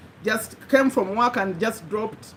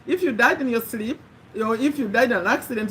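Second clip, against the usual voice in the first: usual speaking voice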